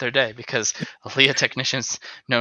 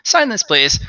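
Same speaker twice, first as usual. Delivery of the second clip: brisk request; 7 semitones higher, 5 dB louder than usual. Acted. [name] interrupting.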